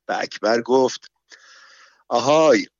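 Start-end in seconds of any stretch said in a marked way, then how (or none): 2.1-2.7 put-on a louder hey to frighten the baby; mock-stern raised hey; threatening call. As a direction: call out sternly to scare the child. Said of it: "برای اینکه بچه را بترساند".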